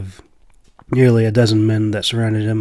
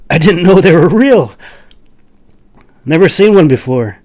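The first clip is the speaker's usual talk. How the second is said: much higher, louder, amazed wonder; dumbfounded and curious; 7 semitones higher, 10 dB louder than usual. Acted. Laclove reacts to news of the unicorn, amazed. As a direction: sound astonished that unicorns exist. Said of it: "slightly dumbfounded and curious".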